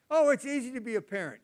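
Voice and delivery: loud, high mock-breezy boast; mocking naive claim. Mocking childless people's claim, loud breezy boast. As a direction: mimic the smug childless know-it-all. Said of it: "mocking people without kids".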